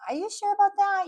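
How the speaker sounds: high, loud, doubting question; doubtful questioning. Retold or imitated. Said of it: "or questioning their motivation".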